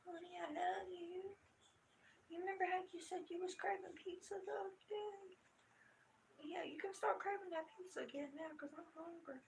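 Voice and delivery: high, soft sweet-talk to a husband; playful wheedling. Pretend. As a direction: coax your husband sweetly, lightly and high. Imagined coaxing of husband for pizza.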